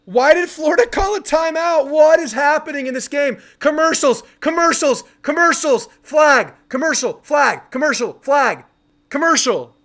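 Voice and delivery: exasperated fan ranting at the broadcast; heated, annoyed. Real, not acted. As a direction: fume at the endless stoppages. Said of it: "I'm annoyed".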